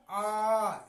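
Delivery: long stretched aa sound; drawn-out aa. Shown as a demonstration. Teacher to pupils, stretching the aa slowly. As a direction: hold the vowel long like a teacher. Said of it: "इसे खच कर पढ़ा".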